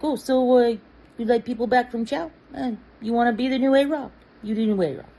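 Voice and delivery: put-on high, fast Brooklyn accent. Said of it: "really thick Brooklyn accent".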